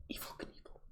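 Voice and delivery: breathy hushed echo; hushed reverent aside; awed whisper. Acted. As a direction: whisper the name like a legend. Echoes the name in awe.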